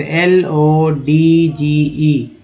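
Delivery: letters spelled one by one, very slowly; slow letter-by-letter spelling. Shown as a demonstration. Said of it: "अब जैसे DG से".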